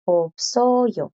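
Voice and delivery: "Opsoyo" with the S clearly sounded before the vowel; S audible. Shown as a demonstration. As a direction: let the S ring out in "Opsoyo". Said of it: "La S suena sin problema". ("Opsoyo" con la S bien pronunciada ante la vocal)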